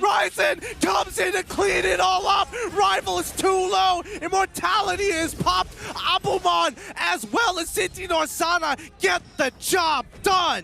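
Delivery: slow shouted kill call; esports caster. Acted. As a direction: roar the kill out slowly. Hype esports play-by-play.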